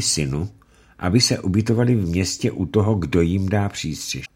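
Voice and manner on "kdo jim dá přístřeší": ordinary delivery, nothing marked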